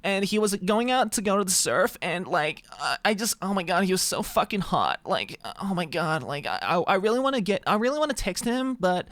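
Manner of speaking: high, mock tearful vocal-fry gush; hammed-up lovesick girl, about to cry; gushing girl on verge of tears. Streamer mimics a girl gushing about meeting Jim, nearly tearful. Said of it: "It's what they all sound like".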